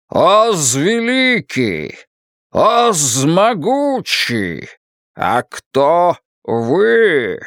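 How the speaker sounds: very slow, grand wizard voice; booming Oz voice. Acted. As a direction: proclaim slowly like a mighty wizard. Oz announces himself.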